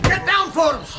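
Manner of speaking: sudden high yell of warning; a man yelling to duck; urgent shout. Acted. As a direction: bark the warning as shots come. Gunfight erupts, sudden warning.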